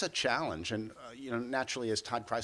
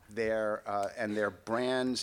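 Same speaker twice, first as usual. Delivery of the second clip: ordinary talk, nothing marked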